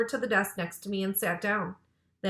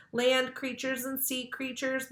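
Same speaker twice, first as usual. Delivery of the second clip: usual speaking voice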